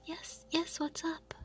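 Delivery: ordinary talk, nothing marked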